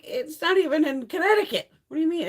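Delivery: high, loud disbelief; exasperated protest. Retold or imitated. Streamer arguing with her box's tracking, exasperated protest.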